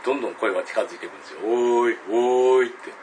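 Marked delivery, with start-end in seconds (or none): 1.4-2.7 loud drawn-out call; eerie voice closing in; approaching call. Retold or imitated. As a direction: unseen voice almost upon him, loud. Voice drawing nearer.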